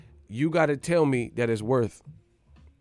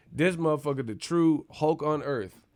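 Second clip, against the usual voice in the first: ordinary delivery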